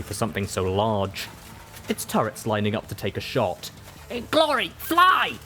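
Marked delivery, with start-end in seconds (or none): 4.3-5.4 high, desperate yell; shouting. Acted. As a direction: scream the warning. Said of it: "I shouted".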